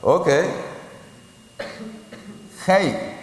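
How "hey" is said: "Hey" said with a lifted pitch as a letter name; Hebrew letter Hey. Shown as a demonstration. Teacher introduces the Hebrew letter Hey, pitched up. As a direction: model "Hey" for the class. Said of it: "Es como si dijeras".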